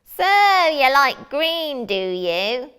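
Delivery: high, loud sneering wizard; mocking wizard. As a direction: taunt her like a gloating wizard. Wizard taunts princess.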